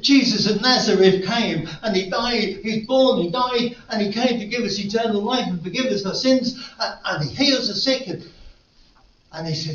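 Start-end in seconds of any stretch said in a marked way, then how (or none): 0.0-8.1 louder, higher fervent proclamation; fervent preaching. Retold or imitated. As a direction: proclaim it with rising fire. Quoting policeman's testimony, preaching fervour.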